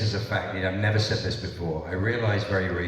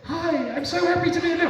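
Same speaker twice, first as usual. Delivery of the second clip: high, chirpy and eager; high chipper voice. Retold or imitated. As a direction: squeak it out brightly. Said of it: "And then".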